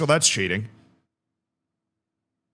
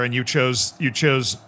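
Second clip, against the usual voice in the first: ordinary delivery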